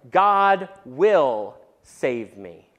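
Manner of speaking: very slow, deliberate God will save me; God will save me, drawn out and firm; hopeful declaration. Retold or imitated. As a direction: declare it slowly with hope. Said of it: "a hopeful declaration".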